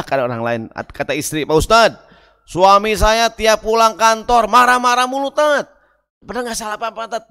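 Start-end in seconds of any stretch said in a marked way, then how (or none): 1.5-5.7 high, loud, slowed whine; a hint of a nagging wife; wife complains to the ustadz about her husband, whining; lightly voiced complaining wife.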